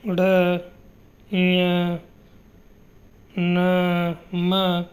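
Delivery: ड़ ञ न म each said separately with pauses; nasal sound demo. Shown as a demonstration. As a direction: model the nasals ञ, न, म one by one. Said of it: "जैसे".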